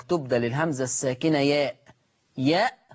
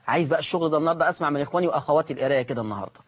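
ordinary talk, nothing marked

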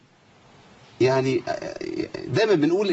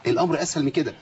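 normal talking voice

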